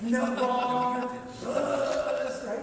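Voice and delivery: loud no, wrong, then a sung right; mock blunt correction. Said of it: "It would have been better".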